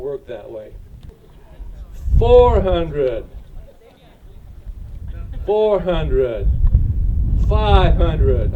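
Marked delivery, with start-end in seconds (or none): 2.2-8.5 loud auction calling of bids; auctioneer. Real, not acted. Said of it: "I feel like an auctioneer".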